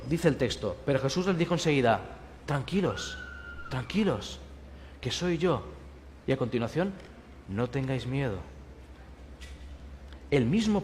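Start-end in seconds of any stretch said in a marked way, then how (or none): 2.4-8.4 soft and gentle; calming. Acted. Jesus calming his frightened disciples, softly. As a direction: quietly settle a scared crowd.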